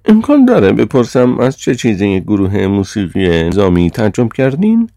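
high, loud demanding question; chairman's high, indignant voice; Sir John, affronted chairman. A narrator's reading. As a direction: press for an answer, rising high at the end.

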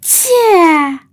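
loud, high acted sneeze. A narrator's reading. (عطسهٔ بلند نمایشی)

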